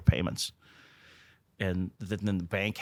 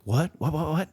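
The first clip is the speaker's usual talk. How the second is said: mock startled, pitched higher, rapid repeats; retold shocked what; 8 semitones higher, 5 dB louder than usual. Accountant hearing the business is failing, mock startled. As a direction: echo the alarm like a jolted accountant.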